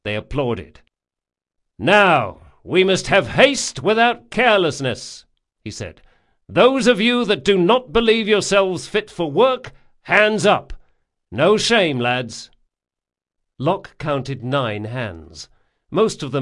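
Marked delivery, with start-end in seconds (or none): 1.8-5.2 loud, firm captain addressing crew; rally the men from the deck; commanding captain. A narrator's reading. 6.5-12.5 loud, firm captain addressing crew; rally the men from the deck; commanding captain. A narrator's reading.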